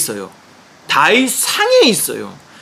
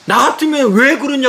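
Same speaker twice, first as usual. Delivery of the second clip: 왜 pushed higher, questioning rise; angry retort; 7 semitones higher than usual. Retold or imitated. Father challenging the pastor on the phone, rising angry question. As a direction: fling 왜 up as a challenge. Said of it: "고함을 막 치니까".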